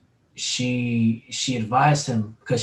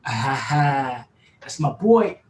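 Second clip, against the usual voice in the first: proud delight; 3 dB louder, much slower than usual. Retold or imitated. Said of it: "I was like".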